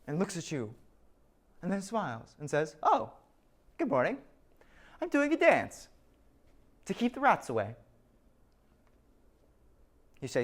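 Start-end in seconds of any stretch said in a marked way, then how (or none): 2.8-7.8 higher, cheery odd-guy voice; breezy and pleased with himself; chirpy Steve. Pretend. Steve explaining his dance, cheerfully high.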